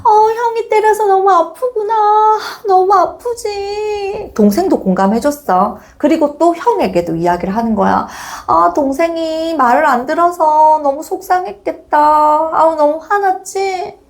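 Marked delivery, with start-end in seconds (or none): 0.1-4.1 high, slow cooing sympathy; drawn-out, high-pitched commiseration; over-empathizing mother. Acted. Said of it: "아이의 마음을 공감해주는 엄마야". 8.4-14.0 high, cooing sympathy; acted mom gushing with understanding; over-empathizing mother. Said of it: "아이의 마음을 공감해주는 엄마야".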